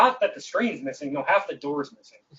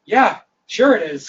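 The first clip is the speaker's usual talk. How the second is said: sarcastic agreement; 6 semitones higher, 8 dB louder than usual. Retold or imitated. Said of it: "And I'm just like".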